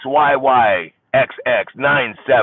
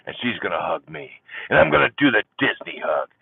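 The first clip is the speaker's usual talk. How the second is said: ordinary delivery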